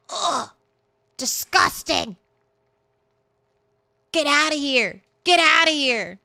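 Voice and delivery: high-pitched scoff of disgust; mock disgust. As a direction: wave the canned corn away in disgust. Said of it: "I'm like".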